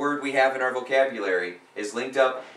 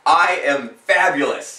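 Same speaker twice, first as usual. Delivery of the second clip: loud, slow affirmation; 5 semitones higher, 10 dB louder, slower than usual. Shown as a demonstration. Coach leading client in affirmation, loud and slow. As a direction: stretch out I am fabulous, big and proud. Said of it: "Go like this".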